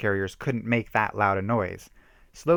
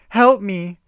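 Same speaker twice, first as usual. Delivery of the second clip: high, loud desperate plea; crying for help; 10 semitones higher, 9 dB louder than usual. A narrator's reading. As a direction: scream for help when cornered. Lost girl calling for help.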